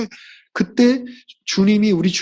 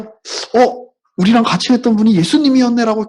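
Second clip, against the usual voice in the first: sudden high, louder realization; realizing surprise; 5 semitones higher, 5 dB louder than usual. Retold or imitated. Disciples suddenly realizing it was Jesus, surprised. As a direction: gasp of recognition, voice jumping up.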